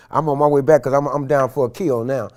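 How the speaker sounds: ordinary talk, nothing marked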